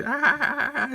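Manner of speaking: high, loud, wobbling mock wail; imitated crazy yell. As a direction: mimic an out-of-pitch, drawn-out yell. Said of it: "she's doing that".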